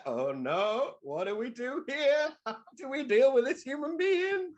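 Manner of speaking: a slow, bewildered little voice; puzzled tourists. Retold or imitated. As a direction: tourists wondering what to do with a sleeper, slow and high-pitched.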